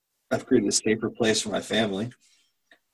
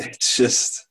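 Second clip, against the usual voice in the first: normal talking voice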